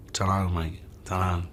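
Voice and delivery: low-key laddish London mumble; quiet bloke-down-the-pub accent; mock British bloke. Shown as a demonstration. Actor demoing being British for the interviewer, soft London accent. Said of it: "being very British".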